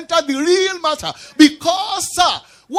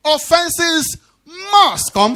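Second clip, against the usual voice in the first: "must" punched loud and clipped apart from "Offenses"; preacher hammering "must" loudly; stress on MUST, loud; 4 semitones higher, 4 dB louder, much slower than usual. Shown as a demonstration. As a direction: shout the word "must". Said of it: "stressing the word must".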